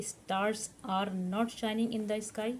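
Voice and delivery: very slow, word by word for students to write; slow, careful dictation pace. Shown as a demonstration. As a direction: spell it out slowly for the class to copy. Teacher dictating English sentence.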